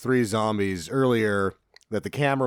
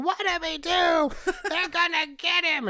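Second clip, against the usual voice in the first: mock panicked scream. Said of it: "everyone's screaming".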